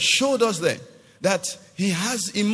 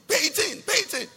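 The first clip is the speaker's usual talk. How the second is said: high-pitched, urgent repeated order; insistent command; 9 semitones higher, faster than usual. Acted. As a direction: press the order home in a high voice. Voices God's insistent command.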